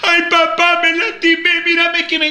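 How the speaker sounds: put-on high, whining hurt child; child whining about a scrape; whiny child. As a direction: whimper to papa like a hurt kid.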